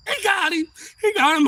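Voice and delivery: high, loud retold cheer; imitating thrilled cops hollering; excited calling out. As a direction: call it out across the yard.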